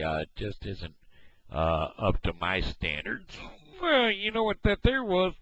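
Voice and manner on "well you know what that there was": high, loud, strained voice through a spasm; muscle spasm. Real, not acted. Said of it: "That there was a muscle spasm".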